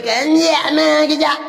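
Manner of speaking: a hammed-up yelled question; shouted question. Retold shouted question in story.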